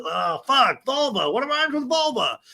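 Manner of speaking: high, strained comic voice; mock frustration. Castmate stuck hunting for a rhyme, mock frustration. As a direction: squeal it as if stumped.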